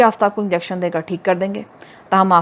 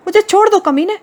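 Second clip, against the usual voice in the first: very high, loud cry to be let go; furious cry; 12 semitones higher, 4 dB louder than usual. A narrator's reading. Hoor held by the hands, high furious cry to be released. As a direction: scream at him to let go. Hoor struggling in Usama's grip.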